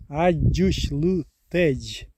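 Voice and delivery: Аджушлютедж very slow with clear Дж sounds; slow careful demo. Shown as a demonstration. After spelling it letter by letter, slow and clear. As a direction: spell Аджушлютедж out slowly, stressing the Дж. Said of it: "звук Дж".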